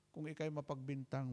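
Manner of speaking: ordinary talk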